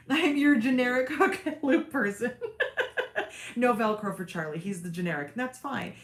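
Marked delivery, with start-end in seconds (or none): 0.0-2.4 real laughing. Real, not acted. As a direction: giggle through the words. Laughing over viewers' velcro joke.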